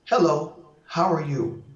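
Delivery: ordinary talk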